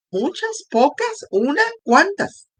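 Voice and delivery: high questioning lift on each word; pointed rhetorical questions; rising questions. Real, not acted. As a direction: probe the listener, pitch climbing.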